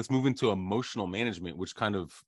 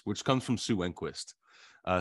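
normal talking voice

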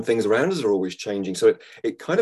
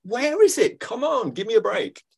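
hammed-up exasperated plea, climbing much higher; mock-forsaken plea; 5 semitones higher than usual. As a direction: wail at heaven in mock despair, pitch building. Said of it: "like Jesus sometimes".

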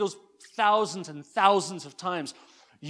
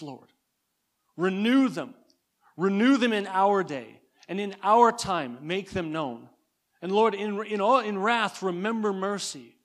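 louder, pleading prayer read with awe; fervent prayer; 4 dB louder than usual. Acted. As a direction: cry it out to God, pleading. Prays Habakkuk's prayer aloud.

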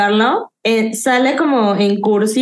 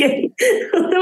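high giggle; 9 semitones higher than usual. Real, not acted. Laughing at tweeting habit.

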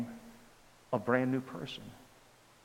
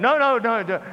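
flustered protest; 7 semitones higher, 6 dB louder than usual. Retold or imitated. Flustered refusing another prayer.

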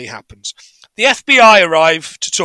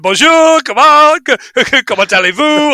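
higher, louder, hammed-up classroom French; mock French teacher; 7 semitones higher, 4 dB louder than usual. Teacher greeting the kids in French, hammed-up and high. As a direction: play the keen teacher showing off her French. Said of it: "this teacher's been going around going".

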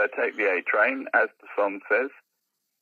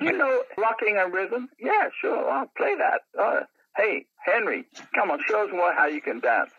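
excited patrons; 9 semitones higher than usual. Retold or imitated. Voicing the regulars at the bar.

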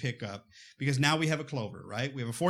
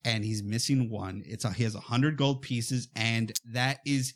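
ordinary delivery, nothing marked

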